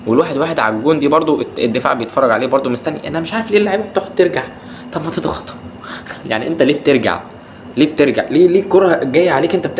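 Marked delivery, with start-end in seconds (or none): 3.1-5.5 higher and fast, fed-up complaint; exasperated rant. Real, not acted. Fan venting at his team's backpedalling defenders, exasperated. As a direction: throw up your hands at the players.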